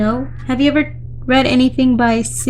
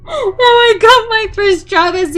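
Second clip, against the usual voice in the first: laughing through tears; 9 semitones higher, 4 dB louder than usual. Acted. Laughing confession turns teary.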